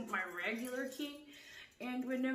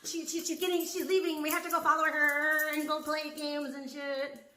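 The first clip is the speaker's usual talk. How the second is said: excited, louder mock neighbors; 3 semitones higher, 12 dB louder than usual. Mocking neighbors eager to follow her out. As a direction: ham up the neighbors getting eager. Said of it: "the Milgrams are like".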